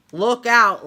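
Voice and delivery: usual speaking voice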